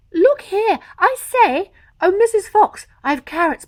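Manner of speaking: high, loud joyful call; excited calling. Acted. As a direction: call out with delighted discovery. Mister Fox calling out.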